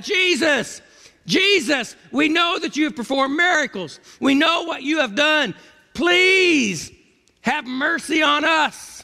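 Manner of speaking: high, straining cry for mercy, slowing on the last words; desperate pleading cry. Acted. Lepers calling to Jesus from a distance, high desperate cry. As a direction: call out to Jesus as if your life depends on it. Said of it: "They yelled out".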